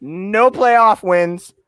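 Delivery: high, dramatic introduction with long pauses; hammed-up showman's reveal, slowing down; mock announcer. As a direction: build up a surprise guest like an emcee. Announces a new fan guest.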